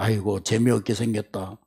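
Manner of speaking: low, soft, bored grumble; bored sigh. Retold or imitated. Man touring heaven, muttering bored to himself.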